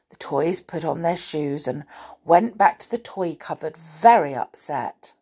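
usual speaking voice

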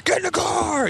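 very high-pitched yelled order; hammed-up parental yell; mock dad's shout. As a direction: imitate a furious dad bellowing. Dad ordering child into the car.

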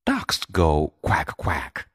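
gently firm mother, teaching a puppy; patient mother coaching her pup. Acted. Mother scolding George in a story.

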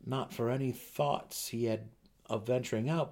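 ordinary delivery

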